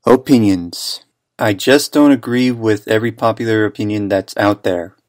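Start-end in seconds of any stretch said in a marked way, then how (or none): 0.1-1.0 annoyed. Acted. Narrator answers the heckler, annoyed.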